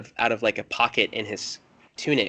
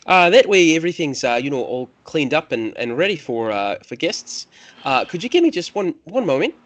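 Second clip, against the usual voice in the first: higher-pitched, halting Irish lilt; Irish accent; 5 semitones higher, 5 dB louder than usual. Acted. Priest asks guests for one moment, hesitant Irish accent. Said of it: "I'm going into an Irish accent there".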